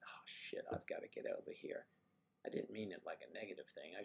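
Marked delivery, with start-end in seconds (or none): 0.1-1.9 hushed inner voice realizing a task; whispered retelling. Retold or imitated. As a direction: whisper the remembered panic. Retold panic at home.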